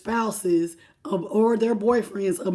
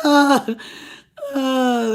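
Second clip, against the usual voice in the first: mock crying; 2 semitones higher than usual. Mock sobbing at the cards.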